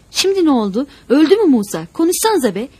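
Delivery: high, loud, alarmed questions; desperate shouting. A narrator's reading. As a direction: yell into the silence for an answer. Captive yelling into sudden silence.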